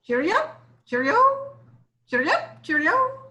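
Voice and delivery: high, quick cheerful robin call; robin song. A narrator's reading. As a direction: sing it out like a bright little robin. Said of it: "starts the morning with a cheerful wish".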